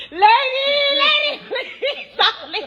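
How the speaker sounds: high, slow, pleading cry of a desperate man; begging man. Retold or imitated. A late traveller begging the gate agent, high pleading wail.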